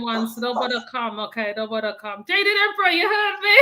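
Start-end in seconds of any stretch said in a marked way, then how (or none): 2.2-3.6 high, loud playful call-out. Real, not acted. Calling out to the comedian Jedid Emperor, loud and teasing. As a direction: shout his name across to him, teasing.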